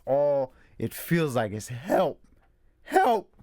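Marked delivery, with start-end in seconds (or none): 1.7-3.3 rising from a cry to a high loud wail; building mock wail. Woman screaming on the phone, mock wail building high and loud. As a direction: climb into a desperate shriek. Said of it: "She is just wailing".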